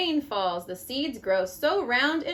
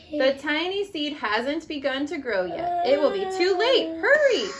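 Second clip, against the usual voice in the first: rising higher and louder to an urgent call; urgent building to hurry; 4 semitones higher, 3 dB louder than usual. Acted. Mom reading the tiny seed's race to grow, urgent. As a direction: push the tension up to hurry.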